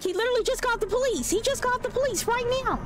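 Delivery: high-pitched, fast, incredulous outburst; shocked disbelief. Real, not acted. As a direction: squeal it in quick disbelief. Reacting to in-game police call.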